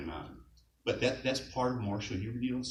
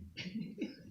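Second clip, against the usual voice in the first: laugh; 13 semitones higher, 11 dB quieter than usual. Real, not acted. Laughs recalling Russian teasing.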